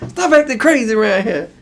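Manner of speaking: laughing at the host's antics. Real, not acted. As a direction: crack up as you say it. Cohost cracking up.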